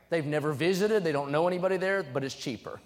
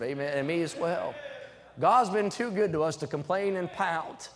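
usual speaking voice

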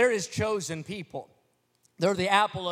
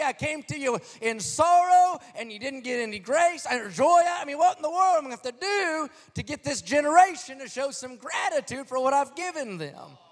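higher, pleading exasperated voice; exasperated God; 6 semitones higher than usual. Acted. God complaining to his people who won't respond, higher exasperated pleading. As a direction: plead like a let-down father. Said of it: "God must feel like that".

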